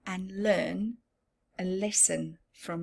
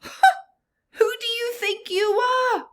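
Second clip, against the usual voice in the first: high, loud, slow outraged cry; indignant cry; 9 dB louder, slower than usual. Acted. Rainbow Fish rebuffing the little fish, outraged cry. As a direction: cry out in haughty anger. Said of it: "cried the Rainbow Fish".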